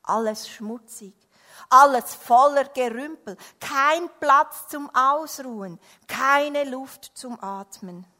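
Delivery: exasperated rant, building in volume; loud storybook grumbling; shouted complaint. A narrator's reading. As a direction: list the faults loudly, stretching the last ones. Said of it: "schrie er".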